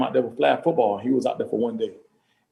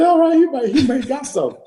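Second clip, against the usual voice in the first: impressed surprise; 11 semitones higher, 3 dB louder than usual. Retold or imitated. Dad sees son score.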